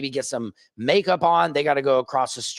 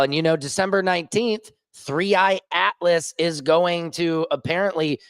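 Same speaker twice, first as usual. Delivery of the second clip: ordinary talk, nothing marked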